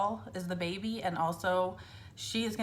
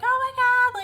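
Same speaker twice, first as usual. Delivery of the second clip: much higher and louder squeal; excited scream; 10 dB louder, faster than usual. Retold or imitated. Mother and nurse screaming over the news, excited scream. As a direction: shriek with joy. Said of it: "hear us screaming right now".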